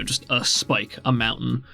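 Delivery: ordinary talk, nothing marked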